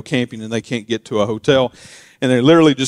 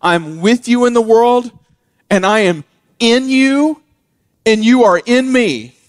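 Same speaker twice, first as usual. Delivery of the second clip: raised, earnest voice of God's promise; voicing God; 9 semitones higher, 5 dB louder than usual. Pastor voicing God's promise to believers, raised and earnest. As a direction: speak as God reassuring his people, higher and louder. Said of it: "He's saying".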